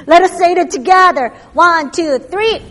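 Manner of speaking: loud, fast rallying call; rallying shout. Real, not acted. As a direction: fire up the crowd. Rallying congregation to say together.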